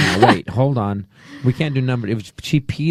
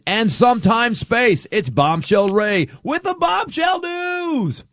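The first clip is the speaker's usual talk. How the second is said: news announcer; 8 semitones higher, 3 dB louder than usual. Acted. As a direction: build up like a showbiz anchor. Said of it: "let's do, uh, one news story".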